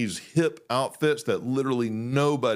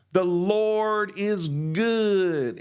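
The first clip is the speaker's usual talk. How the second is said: slow, drawn-out Georgia pastor voice; preacher drawl; 6 semitones higher, much slower than usual. Retold or imitated. Imitating his old Georgia pastor preaching, slow preacher drawl. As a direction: stretch every word like a Southern preacher. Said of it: "he talked like this".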